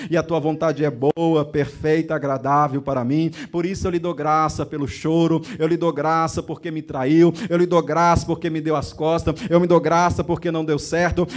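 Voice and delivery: rising from murmured to full-voiced pleading prayer; fervent prayer. Preacher voicing a struggling believer praying, building to fervent.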